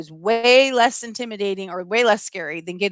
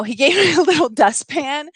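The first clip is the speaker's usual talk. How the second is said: usual speaking voice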